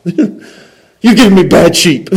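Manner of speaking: high, loud, drawn-out gripe; hammed-up slow complaint; mock complaint. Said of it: "The fairness says".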